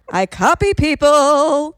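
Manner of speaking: put-on loud, high, drawn-out drama kid. Drama kid confessing why they do accents, hammed-up. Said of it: "the drama kid in us".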